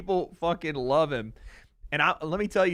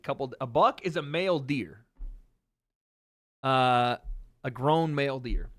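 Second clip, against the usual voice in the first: ordinary talk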